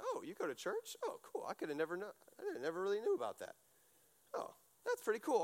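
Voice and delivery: a lightly mocking, high-pitched voice for someone who just learned you go to church; surprised acquaintance. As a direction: play the friend who's pleasantly surprised. Invitee's surprised reaction to church.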